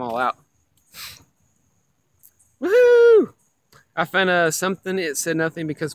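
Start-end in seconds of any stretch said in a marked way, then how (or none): 2.6-3.3 high, loud cheer; excited whoop. Real, not acted. Streamer cheering a lucky catch, loud high whoop. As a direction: whoop it out in triumph.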